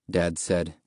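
ordinary delivery, nothing marked

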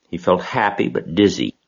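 ordinary delivery

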